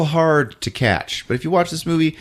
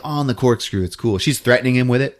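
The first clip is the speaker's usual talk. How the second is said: normal talking voice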